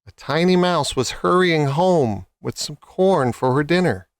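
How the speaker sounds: usual speaking voice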